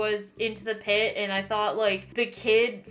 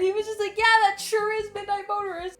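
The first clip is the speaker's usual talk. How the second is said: high-pitched, laughing exasperation, much louder; laughing disbelief; 13 semitones higher, 7 dB louder, faster than usual. Real, not acted. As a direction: laugh through the outrage, way up high. Retelling baffling reply, laughing.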